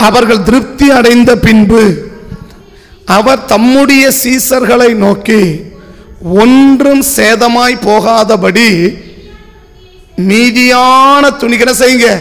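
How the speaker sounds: slow, measured Bible reading; scripture reading. A narrator's reading. Preacher reads the verse about leftovers to the congregation, slow scripture reading. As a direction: read the verse out slowly, phrase by phrase.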